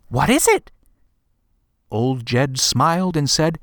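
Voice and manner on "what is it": put-on high, eager boy asking; a boy gasping at a gift; surprised child. A narrator's reading. Boy after gasp.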